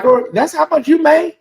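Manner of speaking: high mock-astonished girl; a light imitation of Monique stunned at her co-host's pay; imitating shocked Monique. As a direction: play Monique gawking at the salary. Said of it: "Monique was telling her".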